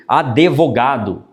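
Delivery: "Advogado" with an added e, a-de-vo-GA-do; drawn-out "Advogado" as adevogado; pronúncia errada de advogado. Shown as a demonstration. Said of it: "falar coisa errada". ("Advogado" com e intruso, adevogado)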